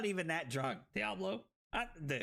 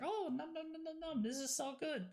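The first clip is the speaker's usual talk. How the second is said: higher, softer voice of yummy enjoyment; mock gobbling delight; 8 semitones higher, 5 dB quieter than usual. Streamer imitates enjoying flavored water, mock-delighted nomming. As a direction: play-act munching happily. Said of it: "and then it's like".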